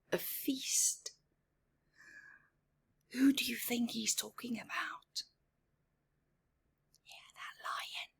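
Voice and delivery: hushed, quick whisper; teasing whisper about the lion; spooky whisper. A narrator's reading. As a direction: whisper the scary part. Scary hint to children.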